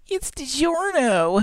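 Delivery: very high, slow announcement; high-pitched ad voice. Retold or imitated. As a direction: squeal the brand name slowly. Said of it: "high-pitched, irritating voice".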